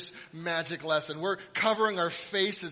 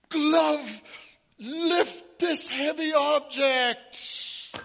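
high, loud, slow incantation; pompous magic command; 10 semitones higher, 8 dB louder, much slower than usual. Retold or imitated. Magician commanding the glove to lift the object, pompously. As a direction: drag out the spell for effect. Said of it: "He'd be like".

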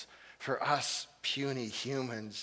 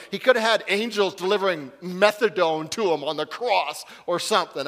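ordinary delivery

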